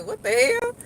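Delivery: high squeal of disbelief; mock surprise.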